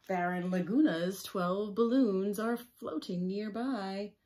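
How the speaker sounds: slow, loud read-aloud; storybook reading. A narrator's reading. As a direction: read it out slowly to the children.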